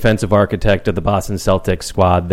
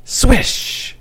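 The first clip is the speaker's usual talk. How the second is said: whispered swish. Pretend. Whispered swish sound effect.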